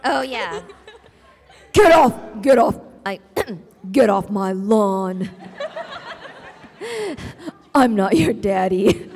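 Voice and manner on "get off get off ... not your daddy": loud gruff villain, laughing; Reaper in Diva's voice. Acted. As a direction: growl it out through laughs. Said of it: "do Reaper in Diva's voice".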